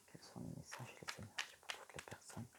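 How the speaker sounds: breathy whisper to the cards; whispered invocation. Real, not acted. Reader whispers her request to the oracle. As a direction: murmur the request and thanks like a prayer.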